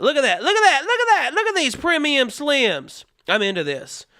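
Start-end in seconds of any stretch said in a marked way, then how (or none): 0.0-3.0 building from casual to high, louder excitement; mock sales hype. Host pitching rolling products, mock excitement climbing. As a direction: hype it up higher with each repeat.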